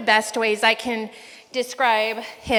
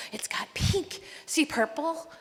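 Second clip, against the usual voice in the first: put-on toddler voice; 4 semitones higher, 7 dB quieter than usual. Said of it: "two-year-old cute little things".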